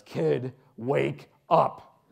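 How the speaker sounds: punched wake-up call, loudest on the last word; commanding announcer voice. Retold or imitated. Announcer returning to the boy's bedside, commanding. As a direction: imitate an announcer rousing a patient. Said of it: "walks in a second time and says".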